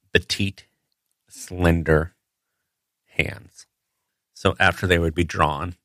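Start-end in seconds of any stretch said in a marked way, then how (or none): 3.1-3.7 whispered word. Real, not acted. Whispered punchline about petite hands.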